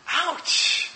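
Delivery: a sharp, high, whispered ouch. Pretend. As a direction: wince up high as the blow lands. Pharisees stung again, harder.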